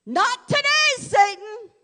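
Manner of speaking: high, loud defiant shout; yelled. Shown as a demonstration. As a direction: yell it at Satan from the rooftops. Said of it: "other times we need to yell it from the rooftops".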